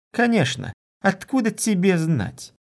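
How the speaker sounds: high-pitched, huffy retort; scornful snort. A narrator's reading. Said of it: "фыркнув".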